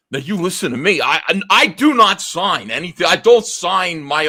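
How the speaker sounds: put-on a stern, furious old man; stern anger. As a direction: lay down the law to a brash kid. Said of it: "furious with me".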